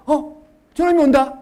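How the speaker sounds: loud sudden recognition; surprised father spotting his son; joyful recognition. Pretend. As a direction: call out at the sight of him. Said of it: "아버지가 알아본 거예요".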